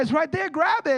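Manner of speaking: high, loud coaxing; urging baby. Retold or imitated. Father urging daughter to grab toy, high and loud.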